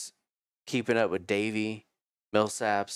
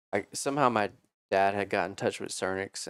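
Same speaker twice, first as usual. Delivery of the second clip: usual speaking voice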